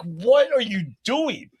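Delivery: high, drawn-out mock disbelief; bewildered. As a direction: stretch it out high, imitating total bafflement. Retold bewildered reply to panicking girlfriend.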